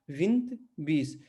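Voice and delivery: विंत slow with a nasal इं and the final e dropped; Portuguese vinte. Shown as a demonstration. Teacher slowly models vinte with its meaning. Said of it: "जैसे विंत".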